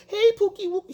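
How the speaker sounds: mock baby talk in a high voice; up-high frequency. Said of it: "up here with your frequency is way up here".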